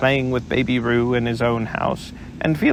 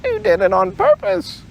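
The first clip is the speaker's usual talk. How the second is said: much higher, stammered splutter; spluttering Piglet. Acted. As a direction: splutter it high through soap. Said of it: "spluttered Piglet".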